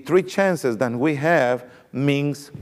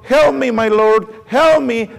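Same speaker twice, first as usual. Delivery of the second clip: higher, louder pleading cry for help; a retold wail of a desperate woman; crying plea; 6 semitones higher, 4 dB louder than usual. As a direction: beg the king in mock distress, pitched up and loud. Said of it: "one lady was crying and crying and says".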